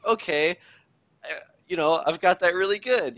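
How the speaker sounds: a self-assured gamer convinced his build works, mock-upbeat; mock confident. Hopeful thought about spirit regen.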